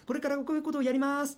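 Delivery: high, projected event-host delivery, endings lifted; event MC announcement. Shown as a demonstration. Event MC addressing a crowd, lifted big voice. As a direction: announce it to a wide hall. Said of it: "ちょっと大きな声でね".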